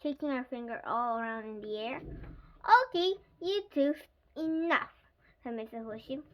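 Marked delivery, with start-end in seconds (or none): 2.8-4.9 raised, loud telling-off; firm teacher. A narrator's reading. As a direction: break up the squabble firmly. Teacher breaks up pupils' fight.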